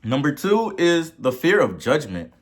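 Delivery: ordinary delivery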